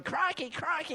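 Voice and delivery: high British exclamation; mimicking a British cartoon hamster; British crikey. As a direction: squeak it like a cartoon Brit. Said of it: "so I started going".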